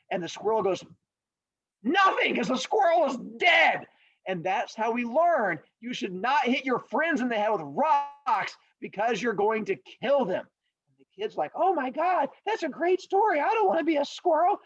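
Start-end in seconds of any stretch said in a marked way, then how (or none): 1.8-3.9 high, loud mock outburst; emphatic comic punchline. Storyteller delivers the squirrel's fate, high and emphatic. 11.5-14.6 high-pitched, over-excited reaction; mock amazed kids. Kids react to the old man's fable, hammed-up excitement.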